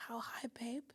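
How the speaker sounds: soft unvoiced whisper, mock eager to please; whispered eager reply. Girlfriend mock-answering her boyfriend's order, whispered. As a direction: breathe it like a devoted girlfriend. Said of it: "I'd say".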